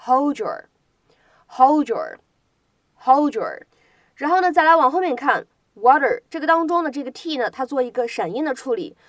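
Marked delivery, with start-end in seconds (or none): 0.0-3.6 "hold your" blended as hold-jer; slow assimilated "hold your"; linked hold your. Shown as a demonstration. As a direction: join "hold" and "your" so the d and y merge. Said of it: "应该读成是hold your".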